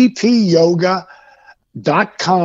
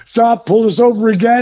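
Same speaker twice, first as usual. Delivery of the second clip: ordinary talk, nothing marked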